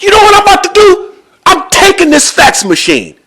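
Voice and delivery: very high, loud outburst; blowing his top. Retold or imitated. Seller threatening to take the fax machine, blowing his top. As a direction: act out someone exploding in rage.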